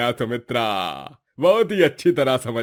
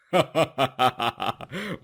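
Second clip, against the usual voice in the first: teasing chuckle. Acted. Brother jokes, then laughs.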